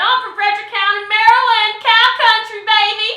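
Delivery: loud singsong hometown brag; proud hollered quote. Retold or imitated. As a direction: belt it like a proud country girl. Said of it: "Soon as I said".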